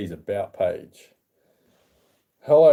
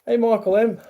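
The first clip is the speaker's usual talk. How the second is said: high bright hello, pitched well up; upbeat high call of hey; cheery viewer greeting; 11 semitones higher, 3 dB louder than usual. Real, not acted. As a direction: sing out a welcome to a new viewer.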